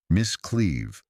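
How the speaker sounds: ordinary delivery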